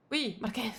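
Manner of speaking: a light Italian lilt on the name; half-hearted Italian accent, as chat asked. Pretend. Streamer answers chat's request with a light Italian accent. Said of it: "Prends l'accent italien".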